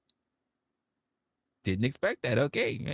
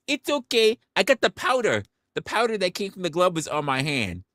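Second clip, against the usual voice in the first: high, breezy nurse voice; mock nurse excuse; 10 semitones higher than usual. Mocking a nurse's powder excuse, high and breezy. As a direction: pitch up and brush it off like a nurse.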